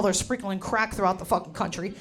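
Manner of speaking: normal talking voice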